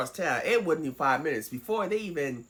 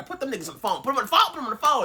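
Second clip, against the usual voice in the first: raised, higher demanding yell; flipping out; 8 semitones higher, 5 dB louder, much faster than usual. Retold or imitated. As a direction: insist loudly to get the cops on the line. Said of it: "she starts flipping out".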